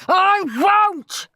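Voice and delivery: high, loud tantrum scream. A narrator's reading. Henry defying Mum over bedtime, screaming. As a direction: scream the refusal, stretching both words. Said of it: "screamed Henry".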